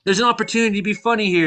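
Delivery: high, quick mock yell; retold yelling. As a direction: imitate calling urgently to someone. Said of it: "I was, like, yelling at you".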